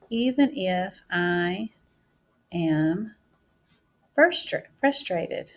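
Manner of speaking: stretched word-by-word writing pace; teacher sounding out words as she writes; slow dictation. Shown as a demonstration. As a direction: keep pace with the pen.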